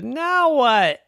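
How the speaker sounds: high, loud panicked wail; panicked kid dinosaur. Acted. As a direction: panic about the broken cup. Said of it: "Stegosaurus said".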